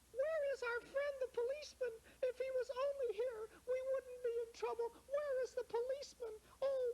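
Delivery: very high and fast; a child calling for rescue; high girl voice, hammed-up panic; panicking little girl. Lara panicking, calling policeman.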